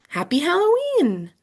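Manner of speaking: high cheerful greeting; clown voice. Acted. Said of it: "sounded just like Missus Wright".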